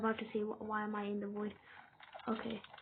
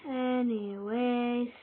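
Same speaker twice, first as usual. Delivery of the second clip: drawn-out anyways; 4 dB louder, much slower than usual. Real, not acted. Drawn-out topic change.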